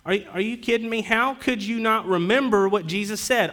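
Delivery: an exasperated scolding; imagined angel chiding the women in disbelief; mock rebuke. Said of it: "rebuke them and say".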